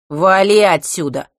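loud, slow command; gruff dismissal. A narrator's reading. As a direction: shoo him off. Dismissing the boy.

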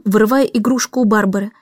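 ordinary delivery

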